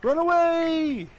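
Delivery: high, loud squealing cheers. Pretend. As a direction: shriek with delight, then panic. Cheering for the game pieces.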